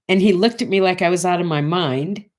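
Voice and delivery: ordinary delivery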